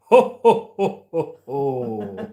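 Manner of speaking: jolly Santa ho-ho-ho, starting loud and trailing away; Santa laugh. Acted. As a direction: play Santa with a booming laugh that falls off. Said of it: "You kind of sound like Santa's accent".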